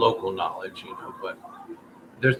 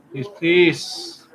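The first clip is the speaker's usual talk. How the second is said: louder pleading, please please; loud begging; 6 semitones higher, 5 dB louder than usual. Pretend. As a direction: beg loudly like a desperate dude. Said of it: "we'll put on the begging dude".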